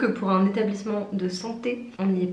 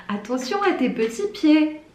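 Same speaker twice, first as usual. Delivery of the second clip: higher-pitched imitation of condescension; patronising little remark; 7 semitones higher, 4 dB louder than usual. Warehouse worker bringing something past her, mock condescending. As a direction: mimic someone warning a small child. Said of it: "des petites réflexions en mode".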